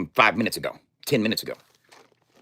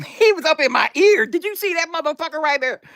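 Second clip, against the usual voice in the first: much higher, excited squeal; squeaky high voice; 13 semitones higher than usual. Real, not acted. Telling the viewers about the cat, squeaky high voice.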